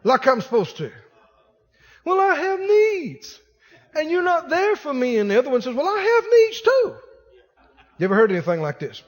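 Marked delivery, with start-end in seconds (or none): 2.0-5.2 high-pitched mock complaint; needy spouse. 5.8-7.0 much higher mock protest; complaining spouse. Other spouse answers back complaining.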